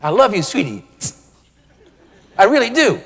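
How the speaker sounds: a loving line said in a sharp, cutting voice; sweet words with a sting in them; pricking tone. Acted. Don't you prick her.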